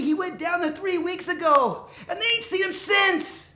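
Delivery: high, loud, agitated goat; panicking. Acted. Little goat warning brothers about the troll, panicking. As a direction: work yourself up into frantic alarm. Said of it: "he started panicking again".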